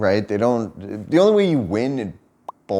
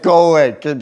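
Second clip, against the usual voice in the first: louder mock "go away, kid"; mock shout; 4 semitones higher, 7 dB louder than usual. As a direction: brush the kid off, hammed-up. Shooing the girl again.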